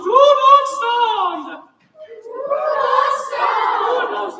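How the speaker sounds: very high, slow; high soprano demo. Shown as a demonstration. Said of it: "Sopranos and altos".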